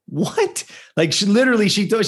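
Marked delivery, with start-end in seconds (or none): 0.1-0.6 sudden much higher what of disbelief; baffled what. Retold or imitated. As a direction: shoot up in pitch, stunned. Mom baffled by odd request.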